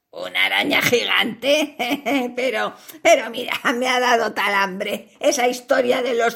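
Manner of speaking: low spider voice with cackling laughs; gloating spider. Acted. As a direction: laugh cruelly at the trapped fly. Spider laughs, threatens to eat.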